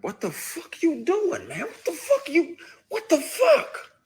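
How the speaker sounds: high, loud, disbelieving yell breaking into a laugh; freaked-out yell. Retold or imitated. Yelling at the man who grabbed him, high shocked shout. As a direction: shout it in shocked outrage, pitch climbing. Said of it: "you spaz even more".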